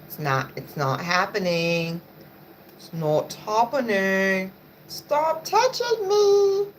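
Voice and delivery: slow, drawn-out refusal; slow exasperated no to the dog; fed up with the dog. Real, not acted. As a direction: deny the pet slowly and firmly. Pushing the dog away.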